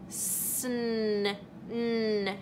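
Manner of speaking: Sn held then n alone; sounding out Sn, n; isolating sounds. Shown as a demonstration. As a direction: stretch the sounds Sn and n apart. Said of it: "keep saying it slowly".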